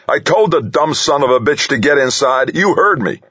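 high-pitched angry shouting. A narrator's reading. Accused man defends himself over his friend's death, shouting. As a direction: shout it down, indignant and insistent. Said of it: "Delaney shouted".

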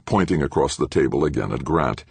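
ordinary delivery

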